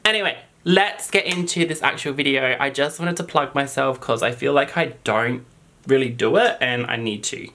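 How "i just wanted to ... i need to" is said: normal talking voice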